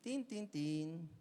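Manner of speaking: slow "Tin, tin, tin" again like a bell; doorbell imitation. The doorbell keeps ringing, slow chime. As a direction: ring it out slowly. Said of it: "el timbre".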